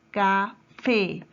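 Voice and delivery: Café split in two, FÉ punched; teacher's syllable-by-syllable Café, weight on last syllable; stress on FÉ. Shown as a demonstration. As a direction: say Café slowly as CA, pause, FÉ. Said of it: "Lleva su mayor fuerza de voz".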